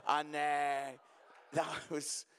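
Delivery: ordinary talk, nothing marked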